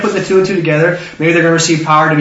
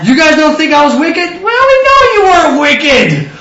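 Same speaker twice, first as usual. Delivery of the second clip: acted exchange, emphatic; 9 semitones higher, 5 dB louder than usual. Job asking about his name, hammed-up emphatic reply. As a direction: exclaim it as an over-the-top reassurance.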